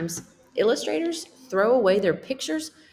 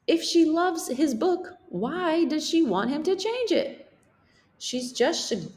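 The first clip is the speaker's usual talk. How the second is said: high character voice; 10 semitones higher than usual. Acted. Dog character speaks.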